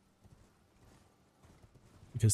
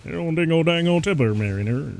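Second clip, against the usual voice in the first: Boomhauer impression; 7 semitones higher, 3 dB louder than usual. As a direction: rattle it off like Boomhauer. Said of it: "talk like Boomhauer".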